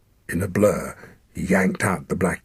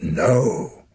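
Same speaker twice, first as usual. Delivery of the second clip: forceful no; 5 semitones higher, 4 dB louder than usual. Acted. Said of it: "with startling force".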